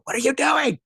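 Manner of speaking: mock-panicked, very high-pitched outburst; alarmed manager. Manager standing behind the speaker, mock alarm.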